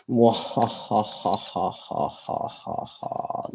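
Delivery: slow, breathy villain cackle; evil laugh. Pretend. Gloating once the project is completed, mock-evil laugh.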